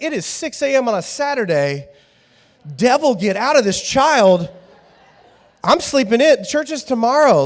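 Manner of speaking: loud, high mock outrage; groggy parent protest. Parent woken at six by child, loud mock exasperation. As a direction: push back the kid, half-joking.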